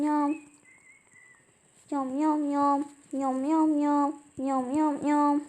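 playful chomping yam yam; mock eating noises. Animals at a picnic, mock munching noises. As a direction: chew it out like a greedy animal.

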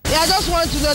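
ordinary talk, nothing marked

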